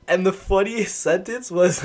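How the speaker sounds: normal talking voice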